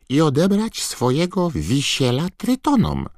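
high, squeaky elf voice; Dobby house-elf voice. A narrator's reading. Dobby explaining. (wysoki, piskliwy głos skrzata)

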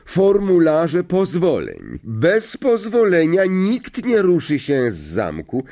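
high, slow, emphatic announcement; stern teacher. A narrator's reading. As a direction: lay down the rule to the class. Teacher instructs the whole class. (dobitnie, tonem nauczycielki)

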